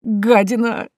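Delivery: high, whining «Гадина», rising at the end; helpless, drawn-out complaint; plaintive whine. Acted. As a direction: wail the insult pitifully. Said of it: "жалобно заскулил дядюшка".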